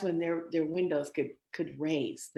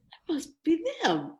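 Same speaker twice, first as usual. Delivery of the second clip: put-on excited young self; 12 semitones higher, 5 dB louder than usual. Young singer spotting the band.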